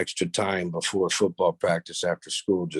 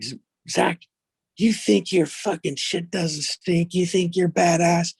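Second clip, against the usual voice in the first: taunting coach; 10 semitones higher than usual. Retold or imitated. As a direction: sneer at the cocky player. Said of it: "He was like".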